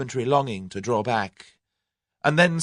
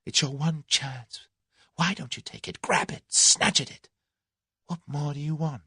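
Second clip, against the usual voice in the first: high, intense, half-whispered urging; urgent self-scolding; 7 semitones higher than usual. A narrator's reading. Tuppence urging herself to grab her chance, exasperated. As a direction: hiss the urgings at yourself, exasperated.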